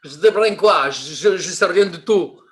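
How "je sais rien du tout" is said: ordinary talk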